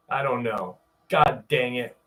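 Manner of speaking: ordinary delivery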